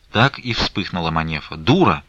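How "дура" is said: mild outcry. A narrator's reading. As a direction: snap the insult out. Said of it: "вскрикнула она".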